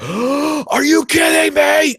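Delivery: outraged moviegoer yelling in disbelief; mock outraged shout. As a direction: belt out disbelief at the surround sound.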